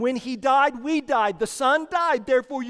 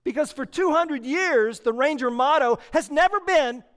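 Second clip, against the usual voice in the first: ordinary delivery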